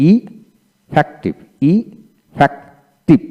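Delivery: very slow effective with gaps between syllables; broken into syllables. Shown as a demonstration. Teacher breaking effective down for learners, slow syllable by syllable. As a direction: pull effective apart piece by piece. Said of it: "এইভাবে ভেঙে ভেঙে".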